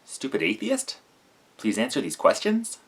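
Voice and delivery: every phrase rising like a question; uptalk. Retold or imitated. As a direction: read it out with mock question tunes. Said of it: "where everything has a question mark at the end of it".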